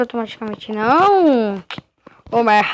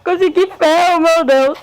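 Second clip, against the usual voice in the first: higher, joyful burst over the iron; excited cheer; 5 semitones higher, 4 dB louder than usual. Real, not acted. Gamer thrilled to find iron in the game. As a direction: celebrate like a big win.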